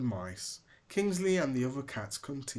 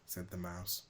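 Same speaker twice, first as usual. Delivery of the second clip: usual speaking voice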